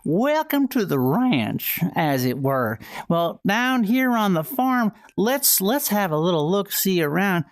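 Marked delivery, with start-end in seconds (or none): 0.0-1.8 big drawn-out Southern howdy, pitched up; hammed-up Southern ranch host; Southern accent. Streamer welcoming y'all to the ranch, hammy Southern accent. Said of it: "we got a Southern accent". 3.1-7.4 folksy Southern farm-host patter; Southern accent. Acted. Streamer describing a little mouse down on the farm, folksy Southern accent. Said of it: "We have a Southern accent redeem".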